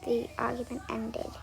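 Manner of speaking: soft, unvoiced whisper. Real, not acted. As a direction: breathe it like a secret. Hushed aside after the rant.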